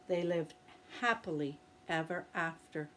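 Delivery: ordinary delivery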